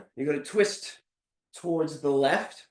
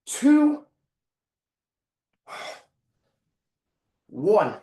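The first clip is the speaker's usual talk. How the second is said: counting call; 3 semitones higher, 7 dB louder, much slower than usual. Real, not acted. Coach calling out countdown.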